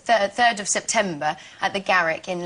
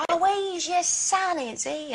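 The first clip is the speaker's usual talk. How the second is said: put-on sing-song old Cockney, higher and slow; old Cockney accent; 8 semitones higher, slower than usual. Shown as a demonstration. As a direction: perform Eliza's musical Cockney. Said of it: "do your Eliza".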